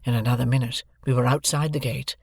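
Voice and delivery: plain reading voice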